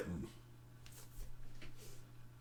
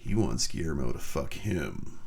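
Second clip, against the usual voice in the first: much lower, dry; deadpan; 10 semitones lower than usual. Acted. Correcting the staff's reading, low deadpan. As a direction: drop it flat and low.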